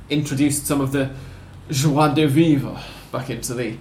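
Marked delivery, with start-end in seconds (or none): 1.7-2.8 slow, put-on French accent. Shown as a demonstration. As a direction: say joie de vivre with a French accent. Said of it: "I apologise for my French accent".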